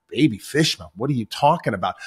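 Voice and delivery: baffled repeat. Retold or imitated. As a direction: throw the words back in disbelief. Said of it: "Billy Crystal looks at him, is like".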